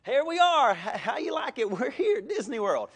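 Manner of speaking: loud, high tour-guide cheer; hammed-up showman presenting Disney World; mock excited host. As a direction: announce the arrival with big excitement. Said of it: "it's like".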